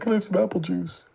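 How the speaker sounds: put-on high, whiny little-boy plea; lightly voiced high sad child; sad whiny kid. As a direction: voice a pouty child asking. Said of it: "'Cause he was sad".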